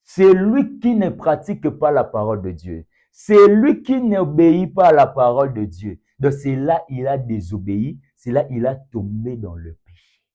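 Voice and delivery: loud, high, insistent preaching; full-force preaching. Real, not acted. Preacher defining disobedience to the congregation, forceful preaching. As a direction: drive each statement home at full voice.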